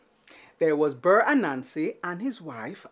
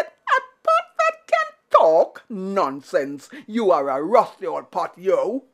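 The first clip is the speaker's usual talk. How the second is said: scoffing Anansi; 4 dB louder than usual. Acted. Anansi scoffing at a talking pot, squeaky.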